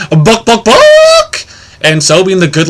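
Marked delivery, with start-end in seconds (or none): 0.1-1.5 clucking book book, then a very high loud squawk; chicken voice. Acted. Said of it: "and the chicken said".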